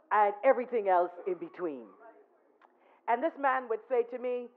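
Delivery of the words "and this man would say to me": ordinary talk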